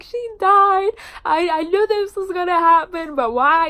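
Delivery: high, loud anguished wail; distraught crying out. Retold or imitated. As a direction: cry it out, heartbroken and disbelieving. Retelling her own reaction to a death.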